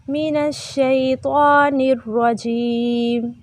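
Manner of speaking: slow, measured chant with long held vowels; Quranic recitation. Shown as a demonstration. Teacher recites for students to repeat, slow chant. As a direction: intone each phrase and stretch the endings.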